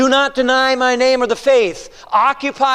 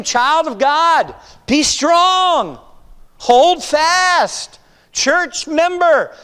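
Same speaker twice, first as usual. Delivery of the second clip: raised, slow, ringing sermon voice; preaching at full force; 5 semitones higher, slower than usual. Acted.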